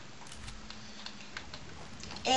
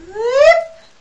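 surprised whoop; 12 semitones higher, 10 dB louder than usual. Real, not acted. Sudden game moment exclaim.

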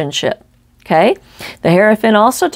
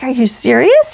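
mildly incredulous, higher-pitched question; retold disbelief; 5 semitones higher than usual. Reader to someone who's never been camping, incredulous. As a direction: act out your astonished reply. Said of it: "I just couldn't believe it".

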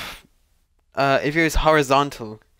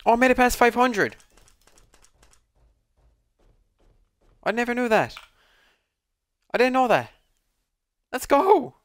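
excited; 11 semitones higher, 3 dB louder than usual. Real, not acted. Reaching door five hundred.

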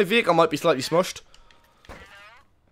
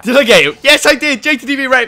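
loud, high victory crowing; triumphant shouting; 8 semitones higher, 8 dB louder than usual. Real, not acted. Gamer to the friend he just got, gleeful shout. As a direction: crow over the hit. Celebrates hitting his opponent.

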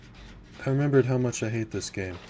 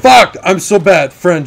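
high shouted curse at himself; screamed curse then loud groan of a line; frustrated yell; 6 semitones higher, 15 dB louder than usual. Real, not acted. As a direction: yell it in self-disgust. Caught by killer again.

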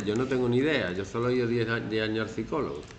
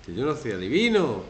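ordinary delivery

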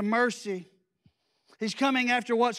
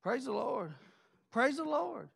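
soft murmured praise; 7 dB quieter than usual. Real, not acted.

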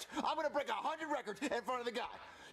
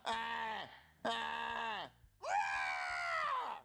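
dinosaur roar; 2 semitones higher, much slower than usual. Pretend. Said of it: "See, I'm a dinosaur".

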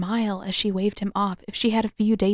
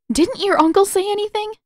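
high, louder, urgent plea; anxious pleading; 12 semitones higher, 6 dB louder than usual. A narrator's reading. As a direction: beg for an answer.

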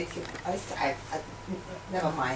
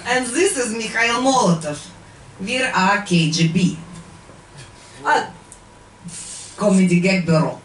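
put-on Russian accent. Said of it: "KGB comedy act".

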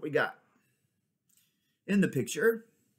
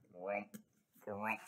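much softer low grumbling; grumpy crab; 4 semitones lower, 11 dB quieter than usual. Acted. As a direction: mumble like a sulky crab.

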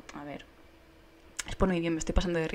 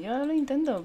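1 exasperated; 8 semitones higher, 6 dB louder than usual. Real, not acted. Frustrated at task.

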